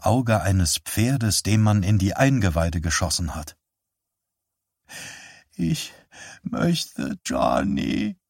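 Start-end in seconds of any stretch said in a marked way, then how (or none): 5.5-8.2 put-on high, thin, slow old woman's voice; frail old mother. Dying mother calls for her son, high and thin. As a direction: ask feebly, drawn out.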